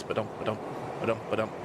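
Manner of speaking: low, soft ba-dum of train wheels; imitated train rhythm. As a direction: chant the track rhythm low and softly. Imitates sound of train tracks.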